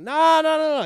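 high, loud playful refusal; mock insistent big cousin; insistent nah. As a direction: cut him off, no arguing.

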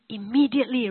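normal talking voice

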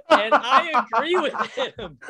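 high laughing agreement. Real, not acted. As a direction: laugh through it.

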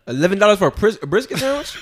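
high, loud disbelieving question; astonished, pitch shooting up into laughter; incredulous. Real, not acted. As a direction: balk at the price, pitch climbing. Incredulous at the sandwich price.

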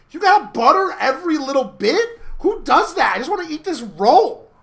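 high, loud, incredulous imitation; mock exasperated protest. Colton objecting to the teacher's butter rule, mock exasperated protest. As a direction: protest it in hammed-up frustration.

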